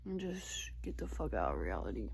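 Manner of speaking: tired whispered wish. Real, not acted. Late at night wishing to escape, a whisper.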